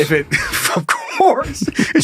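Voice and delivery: laughing mid-sentence; words broken by laughter. Real, not acted. Laughing breaks into line.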